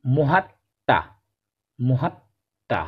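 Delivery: মহাত্মা slow with doubled ত, no ম; pronunciation. Shown as a demonstration. Teacher to pupils, slow model word. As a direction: draw out মহাত্মা. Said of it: "তারপরে দেখো".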